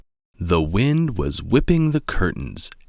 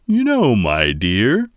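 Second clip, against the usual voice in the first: louder, hearty father bear; Papa voice; 4 semitones higher, 4 dB louder than usual. A narrator's reading. Father bear boasting to his cubs, hearty and loud. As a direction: boom it out like a proud papa bear.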